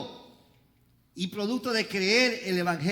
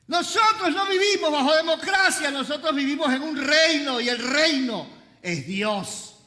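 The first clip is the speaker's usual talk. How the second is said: loud, high-pitched sermon cry; full-force preaching; 6 semitones higher, 6 dB louder than usual. Real, not acted. Preacher rejecting democracy in the kingdom, full-force preaching. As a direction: thunder it out to the congregation.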